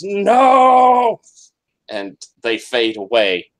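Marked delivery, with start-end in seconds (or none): 0.0-1.2 high loud dying scream. Acted. Edlin's ghost dragged into the waves, screaming. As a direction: cry out as you are dragged under. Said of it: "as he screams".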